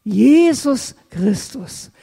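usual speaking voice

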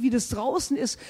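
ordinary delivery

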